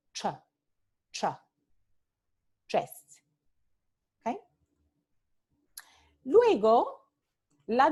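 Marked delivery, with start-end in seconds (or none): 0.1-3.2 ch as in chest; ch affricate. Shown as a demonstration. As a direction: stop then hiss the ch in chest.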